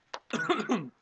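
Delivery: real coughing fit; choking cough. Real, not acted. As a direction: coughs from water gone down wrong. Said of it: "choking on water".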